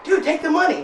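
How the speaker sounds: high-pitched mock pleading; acted urging. Storyteller pressing guy in front seat to accept, urgent. Urging friend to take cash.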